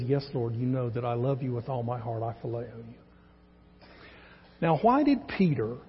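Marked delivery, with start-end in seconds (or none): 0.0-3.9 softer and lower, fading to almost nothing on the last words; reluctant quiet reply. Acted. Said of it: "why was he reluctant to respond back".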